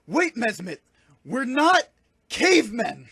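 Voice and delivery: high, loud, emphatic outburst; urgent shout. Acted. Exorcist grabs panicked partner by shoulders, urgent shout. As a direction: shout it in bursts to stop them.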